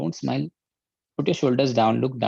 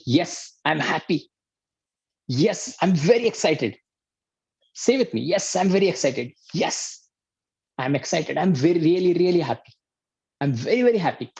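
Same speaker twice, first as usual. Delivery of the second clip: higher, bright "Yes, I'm very excited"; energized and happy; 5 semitones higher than usual. Shown as a demonstration. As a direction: say it with a big smile and energy. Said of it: "really, really start feeling happy".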